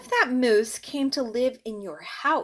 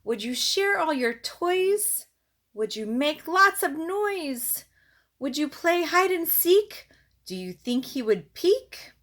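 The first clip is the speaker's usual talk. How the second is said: lively, singsong picture-book read-aloud; reading to children; 6 semitones higher, 4 dB louder than usual. Acted. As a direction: read it to a little one, bouncing the rhymes.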